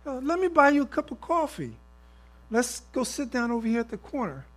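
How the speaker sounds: high-pitched, eager invitation; acted, high-voiced coffee offer; grateful stranger.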